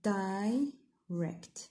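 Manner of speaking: "direct" in two beats, dai, rect; split into syllables. Shown as a demonstration. Teacher dividing the verb for students, "direct" syllable by syllable. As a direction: break "direct" apart with a pause after the first syllable. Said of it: "نقسموها".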